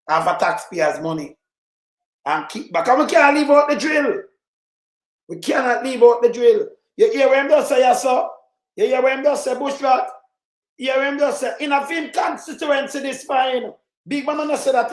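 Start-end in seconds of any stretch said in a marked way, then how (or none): 2.9-6.7 loud, high, insistent shout. Real, not acted. Commentator ranting to his viewers, shouting. 7.0-14.9 excited outcry. Real, not acted. Urging viewers about the politician.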